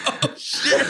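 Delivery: high, laughing oh shit under the breath; stunned laughing exclamation; breathy laughing gasp. Retold or imitated. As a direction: laugh it out in disbelief. Stunned reaction retold.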